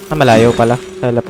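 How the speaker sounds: ordinary delivery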